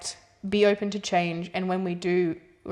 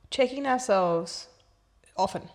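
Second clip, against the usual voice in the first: ordinary talk, nothing marked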